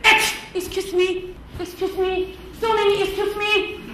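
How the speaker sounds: high, hesitant mimicry of apologizing; mock excuse-me. Worker excusing themselves after a mistake, mock apologetic. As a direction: imitate a worker's repeated high-pitched excuses. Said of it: "You say".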